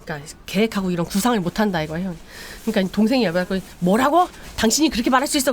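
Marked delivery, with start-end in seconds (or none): 3.8-5.5 enraged brother. Retold or imitated. Younger brother to elder brother, furious retort. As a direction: lash back at him.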